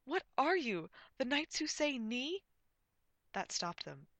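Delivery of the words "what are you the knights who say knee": loud, high, incredulous question; raised-voice indignant query; demanding. A narrator's reading. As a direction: demand to know, exasperated. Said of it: "demanded Gwen".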